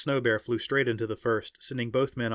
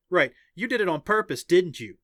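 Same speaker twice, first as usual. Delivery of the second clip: higher, louder teasing; playful accusation; 7 semitones higher, 6 dB louder than usual. A narrator's reading. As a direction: accuse a teammate without real threat. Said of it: "Her accusation sounded more playful than threatening".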